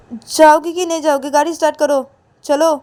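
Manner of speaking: angry order. Acted. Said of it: "तो तभी पायल बोलती कि".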